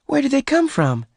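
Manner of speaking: very high, amazed woman's voice; astonished question. A narrator's reading. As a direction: ask it high, in sheer amazement. Bess astonished at the cattle.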